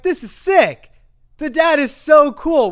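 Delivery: high, loud, gushing excitement; thrilled. Retold or imitated. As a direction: burst out with retold delight. Retells delight over dirt bikes.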